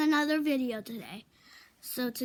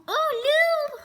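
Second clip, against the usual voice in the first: excited coo; 14 semitones higher, 5 dB louder than usual. Real, not acted. Dog greets her.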